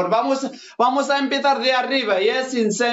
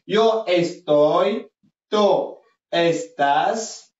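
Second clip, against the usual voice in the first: slow, drawn-out teacher modelling; slow rising list, like reading for the class; slow model drill; much slower than usual. Shown as a demonstration. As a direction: stretch each form out for learners to copy. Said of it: "Sami يسمع مزيان".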